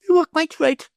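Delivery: high, curt noblewoman; lofty lady. A narrator's reading. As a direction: cut him off grandly. Lady voiced by reader.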